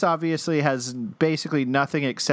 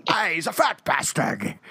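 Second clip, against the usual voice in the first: put-on Scottish accent; 2 semitones higher than usual. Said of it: "I cannot do a Scottish accent at all".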